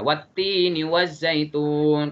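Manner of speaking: "wat-tini waz-zaitun" slow, doubled t and z; slow recited model of "wat-tini waz-zaitun"; recitation with silent L. Shown as a demonstration. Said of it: "kan bacanya gitu ya".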